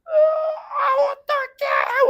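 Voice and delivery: high loud wailing sob; crying voice. Retold or imitated. Teacher imitating a panicking student crying into the phone. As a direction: cry it out in a mimicked panic. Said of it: "막 하면서 막".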